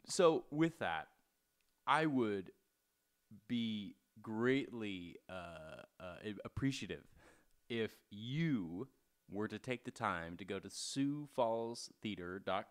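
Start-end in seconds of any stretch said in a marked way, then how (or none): none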